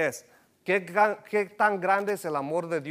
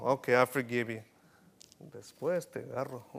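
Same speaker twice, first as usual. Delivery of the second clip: low, grudging mock apology acceptance, trailing off quieter; half-hearted forgiveness; 5 semitones lower, 6 dB quieter than usual. Child grudgingly forgiving a brother in front of parents, half-hearted. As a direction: forgive grudgingly, then mutter a threat. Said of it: "No nomás es perdón como".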